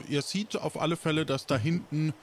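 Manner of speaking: ordinary talk, nothing marked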